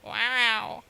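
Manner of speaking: drawn-out nasal-free wow in a put-on voice, a cat doing Owen Wilson; Owen Wilson impression. Shown as a demonstration. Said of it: "a cat doing an impression of Owen Wilson".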